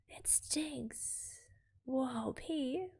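high, squeezed cry of disgust; acted revulsion, high and light; mock disgust. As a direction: recoil from a stink in a small, high voice. Character reacting to bad smell.

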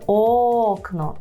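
окно with the first о drawn right out; exaggerated stretched syllable. Shown as a demonstration. Teacher advises exaggerating at first, long stressed о in окно. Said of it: "розтягнути цей склад".

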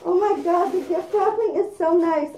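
usual speaking voice

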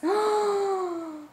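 high, slow squeal of delight; gasp, then squeal it out long and high; head-over-heels thrilled, very high; adoring squeal. Real, not acted. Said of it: "I am so in love with this box".

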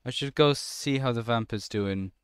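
ordinary talk

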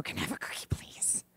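put-on whispered little-girl request; whispered plea. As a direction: ask for a cookie in a sneaky whisper. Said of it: "then she'd go".

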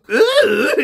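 high, loud put-on exclamation; mock high-pitched outburst. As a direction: squeal it as a hammed-up caricature. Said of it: "It's so easy to do".

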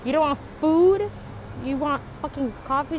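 scolding the sister for her second coffee, loud and incredulous. Retold or imitated. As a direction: tease her loudly for ordering coffee over food. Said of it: "I told her".

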